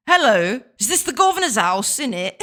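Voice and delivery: loud, cheeky mock-British voice; hammed-up British caller. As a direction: play a nosy British bloke at the door. Streamer plays a cockney visitor.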